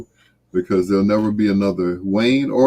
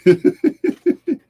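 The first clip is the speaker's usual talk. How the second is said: high burst of laughter; 3 dB louder than usual. Real, not acted. Surprised laugh at Emma's remark.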